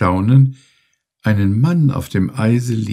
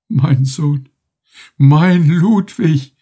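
much higher, louder, very slow anguished cry; wailing in despair; 9 semitones higher, 6 dB louder, much slower than usual. A narrator's reading. Old captain crying for his lost son, slow anguished wail. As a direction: draw out the cry like a broken old man. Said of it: "schrie jammernd in tiefster Verzweiflung".

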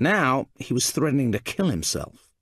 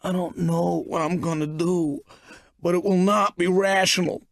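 distraught man; 6 semitones higher than usual. A narrator's reading. Narrator voices distraught Mystery.

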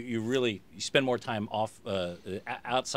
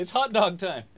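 higher, louder hammed-up announcement; mock good old boy; 10 semitones higher, 8 dB louder than usual. Host riffing on the bit, hammed-up loud tailgate voice. Continuing the joke.

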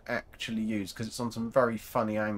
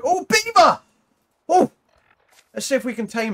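high, loud, delighted squeal at the beaver; really excited; 13 semitones higher, 11 dB louder than usual. Real, not acted. Spotting a beaver while exploring, high and loud real excitement. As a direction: burst out thrilled at spotting an animal. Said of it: "Got really excited then with a beaver".

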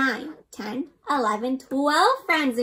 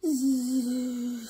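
mock snore. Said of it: "sleeping sounds".

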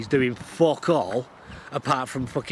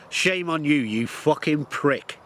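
scornful; 4 semitones higher, slower than usual. Real, not acted. Ranting at TV presenter.

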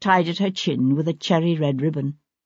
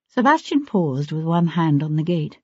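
usual speaking voice

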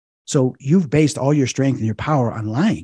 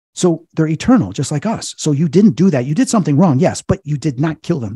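louder, higher, punched emphasis; retold firm rebuttal; 4 semitones higher, 6 dB louder than usual. Exorcist correcting the demon's guilt, emphatic rebuttal. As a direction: drive each point home.

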